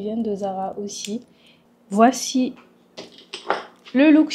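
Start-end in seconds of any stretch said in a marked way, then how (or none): none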